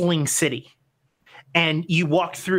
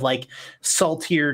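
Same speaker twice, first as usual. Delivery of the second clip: ordinary delivery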